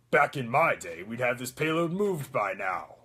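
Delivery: put-on gruffer old-soldier voice; rough it up like a grizzled soldier; Soldier seventy-six. Shown as a demonstration. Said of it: "it's, it's gruffer".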